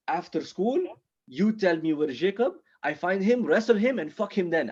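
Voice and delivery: put-on eager plotter with a foreign accent; Ahmed. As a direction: lay out the plan in rising accented English. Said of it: "kinda fresh off the boat".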